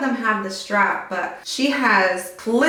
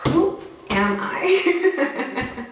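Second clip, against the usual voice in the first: high giggly laugh through words; high laughing self-mockery; 5 semitones higher, much slower than usual. Real, not acted. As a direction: laugh at your own joke. Laughing at herself.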